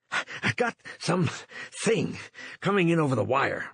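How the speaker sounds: panting out the words, out of breath. A narrator's reading. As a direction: gasp it out, out of breath. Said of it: "Runciter panted".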